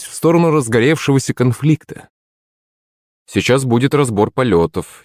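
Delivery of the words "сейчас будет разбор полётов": usual speaking voice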